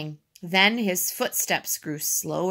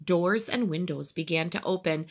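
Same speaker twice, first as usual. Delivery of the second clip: ordinary delivery, nothing marked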